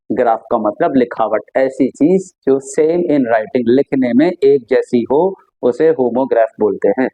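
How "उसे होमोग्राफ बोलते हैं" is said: ordinary talk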